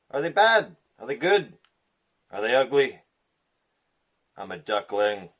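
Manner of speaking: ordinary delivery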